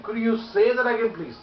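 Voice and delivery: high, loud request with "say" lifted; animated, hearty plea to repeat; full of life. Shown as a demonstration. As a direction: belt out "say that again", drop on "please". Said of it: "coming out with the full of life".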